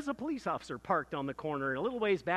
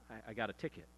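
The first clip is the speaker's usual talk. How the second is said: much softer, halting, afraid to say it; timid confession; 7 semitones lower, 10 dB quieter, faster than usual. Retold or imitated. Boy confessing a traffic ticket to his strict dad, timid and much softer. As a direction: barely get the words out to your father.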